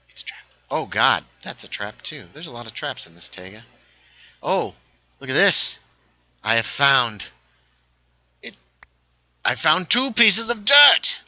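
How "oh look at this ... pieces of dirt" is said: high, loud, slow proclamation; mock-triumphant announcement. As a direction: proclaim it grandly, drawn out and loud. Mock-dramatic find announced.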